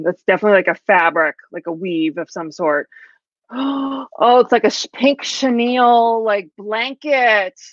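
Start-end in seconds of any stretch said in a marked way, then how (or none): 3.5-4.1 high, soft intake of breath; delighted gasp. Real, not acted. Finding the pink chenille paper, delighted gasp. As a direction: gasp at a lovely surprise.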